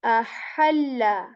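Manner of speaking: ahalla drawn out slowly with a breathy middle ha; ahalla with airy ha. Shown as a demonstration. Teacher letting students hear the difference. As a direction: slowly push air on the ha of ahalla. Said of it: "we say".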